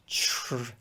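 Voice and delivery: "tr-" softly slurred into a long "chr"; exaggerated English TR. Shown as a demonstration. Teacher showing what T becomes before R, exaggerated "chr" for "tr-". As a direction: overdo the English "tr-", like a drawn-out "chr". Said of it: "I'm exaggerating that".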